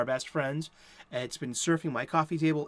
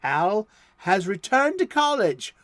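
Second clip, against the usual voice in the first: loud, slow comic-book announcer voice; big old-time serial announcer; dramatic blurb read; 4 semitones higher, 8 dB louder, slower than usual. Acted. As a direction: boom out the blurb like a golden-age radio narrator.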